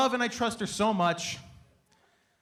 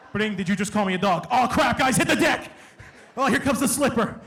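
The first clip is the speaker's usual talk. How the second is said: panicked son; 5 dB louder than usual. Retold or imitated. Mom yelling, son panicking.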